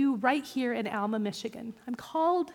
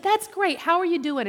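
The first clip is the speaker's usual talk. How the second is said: much higher, louder chirpy greeting; chirpy welcome; 6 semitones higher, 7 dB louder than usual. Retold or imitated. Teller greeting new classmate, high and chirpy. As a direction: gush a welcome to a newcomer. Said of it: "And I said".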